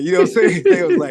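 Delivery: laughing through the words; loud, high laughing aside; cracking up while telling the story. Real, not acted.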